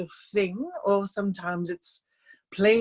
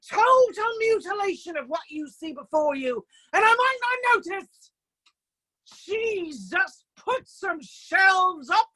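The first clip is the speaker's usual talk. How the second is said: a bellowing artist losing his temper, much higher; outraged shout at a student; George ranting; 6 semitones higher than usual. Retold or imitated. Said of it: "George goes".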